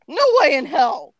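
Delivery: high, loud refusal; hammed-up angry retort; growled refusal. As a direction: refuse it forcefully. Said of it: "I growled".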